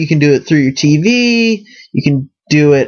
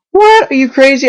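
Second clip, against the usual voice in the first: very high, louder squeal of disbelief; shocked disbelief; 11 semitones higher, 4 dB louder than usual. Retold or imitated. Girlfriend hearing about two pizzas, shocked squeal. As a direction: react like the order is insane.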